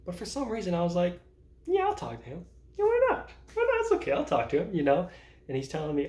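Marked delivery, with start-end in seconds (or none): 1.7-4.7 eager. Retold or imitated. Retold eager agreement.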